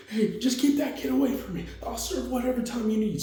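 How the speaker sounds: very high-pitched frightened begging; acted scared plea. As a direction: plead desperately to be kept safe. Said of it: "He's scared".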